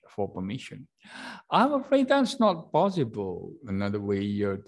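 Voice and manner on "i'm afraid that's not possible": demo refusal. Shown as a demonstration. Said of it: "to refuse".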